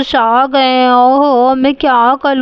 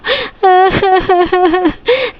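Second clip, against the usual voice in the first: put-on child sobbing; 5 semitones higher than usual. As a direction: cry like a scolded little girl. Girl crying before parents.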